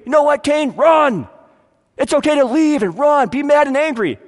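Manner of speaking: high, loud, egging-on shout; mock shouted advice. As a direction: shout it like a reckless friend urging him to run. Voicing a brother's bad advice.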